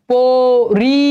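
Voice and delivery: পরি split into long "po" and "ri"; syllable-by-syllable demonstration. Shown as a demonstration. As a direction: stretch both syllables of পরি. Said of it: "একটু খেয়াল করো".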